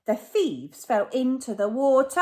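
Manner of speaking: usual speaking voice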